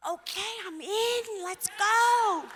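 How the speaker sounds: excited. Retold or imitated. Agreeing eagerly in retold scene.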